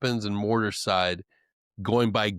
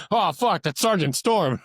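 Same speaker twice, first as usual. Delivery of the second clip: alarmed new guy; 6 semitones higher than usual. Retold or imitated. Startled on seeing feared instructor.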